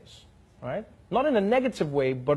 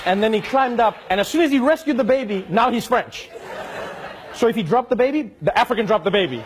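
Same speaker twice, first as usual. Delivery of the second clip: higher, louder and faster toward the punch; building comic outrage; 6 semitones higher, 5 dB louder, faster than usual. Real, not acted. Comedian to audience, the baby-rescue punchline in rising excitement. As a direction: ramp it up, nearly laughing.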